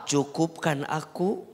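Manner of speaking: slow, chanted Arabic prayer; sung supplication, measured and reverent; prayer recitation. Acted. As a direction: intone the dua slowly and devoutly.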